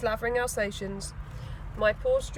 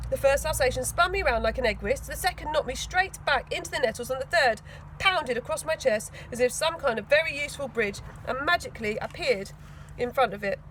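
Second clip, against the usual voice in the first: ordinary delivery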